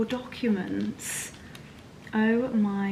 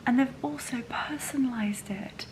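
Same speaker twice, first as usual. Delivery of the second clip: whisper; 5 dB quieter than usual. Real, not acted. Admiring gift.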